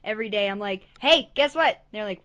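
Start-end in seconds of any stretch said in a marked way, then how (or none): 1.0-1.8 loud, high, eager call; excited pestering. Retold or imitated. Pestering friends with a daily countdown, excited. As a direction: burst in with big news.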